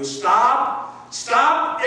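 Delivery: commanding, rising stop; urgent stop; firm stop. Acted. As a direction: call them to halt. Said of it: "Stop in your tracks".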